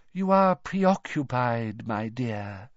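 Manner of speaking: slow, sorrowful seer voice; mournful. A narrator's reading. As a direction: sigh it out with pity. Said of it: "she said mournfully".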